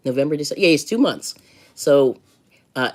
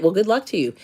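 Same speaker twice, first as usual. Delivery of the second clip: ordinary talk, nothing marked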